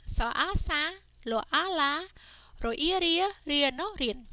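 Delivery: teacher's sing-song spell-and-read drill. Shown as a demonstration. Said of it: "read each word by first saying the consonant".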